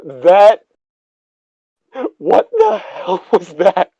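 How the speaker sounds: high, slow, shaky shock; shaken. Real, not acted. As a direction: gasp it out, still rattled. Still shaken after jump scare.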